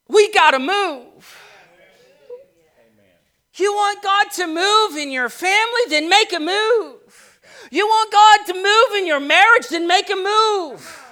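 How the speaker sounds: loud, high, rising preaching. Acted. Pastor urging the congregation, preaching at full force. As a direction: build it like a revival preacher.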